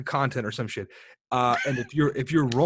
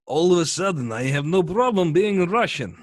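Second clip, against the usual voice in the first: lightly hammed-up Russian accent, higher and louder; mock Russian accent; 5 semitones higher, 6 dB louder than usual. Shown as a demonstration. Friend demonstrating how easy faking a Russian accent is. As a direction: show off a cheesy Russian accent. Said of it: "Let me show you how easy it is".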